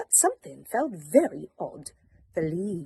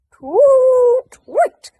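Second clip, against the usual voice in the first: very high, very loud hooting toot; shrill owl hoots of annoyance; cross owl hoot; 17 dB louder than usual. Acted. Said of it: "said the owl crossly".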